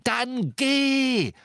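furious, high-pitched roar; enraged command, pitched right up; thundering shout. A narrator's reading. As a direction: thunder the order at them. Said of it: "donnerte Harry".

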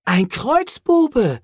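hoch und langsam gedehnt ausgerufen; aufgeregter Ausruf. A narrator's reading. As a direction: fassungslos das Wort herausrufen. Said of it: "rief Cole". (Cole ruft verblüfft beim Anblick der Figur, hoch und gedehnt)

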